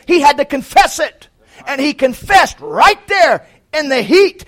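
high, loud, shouting preacher; preaching at full force. Acted. Preacher crying out the name of the Lord to his church, shouting. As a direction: thunder it to the congregation.

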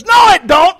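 high, loud mock shout of denial; angry retort. Mennonite cousin shouting back at the preacher, mock angry. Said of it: "Now he's getting mad".

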